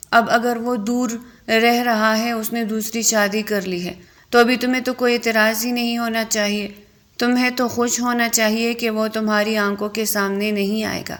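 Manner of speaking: ordinary delivery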